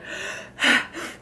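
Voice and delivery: soft breathy sigh of relief; relieved sigh. A narrator's reading. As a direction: let out a shaken breath after the scare. Mrs Mallard catching her breath.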